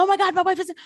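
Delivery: high panicked cry of a husband; imitating a husband who just found his wife on the floor. Husband finds wife collapsed.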